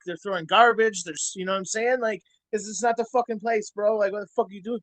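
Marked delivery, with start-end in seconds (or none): none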